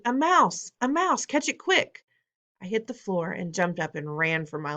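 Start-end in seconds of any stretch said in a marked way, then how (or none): 0.0-2.0 high excited shouting. A narrator's reading. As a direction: call out to catch the mouse. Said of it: "they were shouting".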